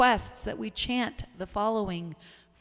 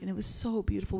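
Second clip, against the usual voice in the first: ordinary talk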